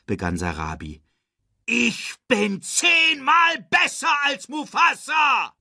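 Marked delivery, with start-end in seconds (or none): 1.7-5.5 wütendes, hohes, langsames Gebrüll; brüllend. A narrator's reading. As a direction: voller Zorn herausschreien. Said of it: "brüllte Scar". (wütendes Brüllen)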